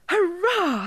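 high, loud hurrah; a child's high whoop of joy; joyful cheer. A narrator's reading. Child cheering over new fairy shoes.

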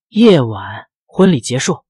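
usual speaking voice

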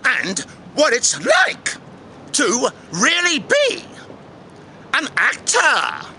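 slow, drawn-out welcome with sudden high notes; theatrical host intro. Acted. Host welcomes viewers to the channel, theatrical intro. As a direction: stretch out a grand show opening.

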